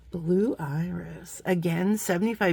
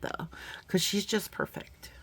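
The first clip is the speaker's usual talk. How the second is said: whispering; 7 dB quieter than usual. Real, not acted. Secret aside about a bead.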